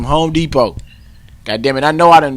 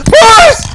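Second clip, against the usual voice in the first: very high loud yelp of a word; karate yell; 9 dB louder than usual. Retold or imitated. Showing off his breaking sound to the class, piercing yell. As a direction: scream police as a kiai.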